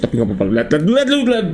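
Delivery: ordinary talk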